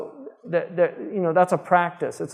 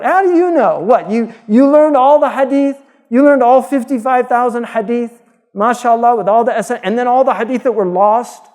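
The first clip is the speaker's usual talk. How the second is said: high, loud incredulous questioning; raised, disbelieving voice with mock admiration; sarcastic challenge; 4 semitones higher, 5 dB louder than usual. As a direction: mockingly grill a know-it-all. Mocking challenge to modern critics.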